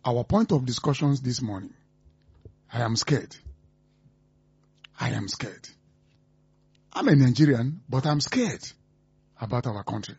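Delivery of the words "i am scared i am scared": low, quiet and grave; hushed dread. Real, not acted. Radio host confessing fear about the country, hushed dread. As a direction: say it low, under your breath. Grave opening refrain of worry.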